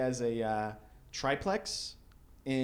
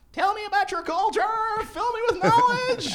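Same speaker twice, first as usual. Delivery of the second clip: much higher, louder eager nerd voice; nerdy eager tourist; 4 dB louder than usual. Retold or imitated. Nerdy tourist begging a Jamaican host for culture. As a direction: gush like a wide-eyed nerd tourist. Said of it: "I was like, I was a total nerd".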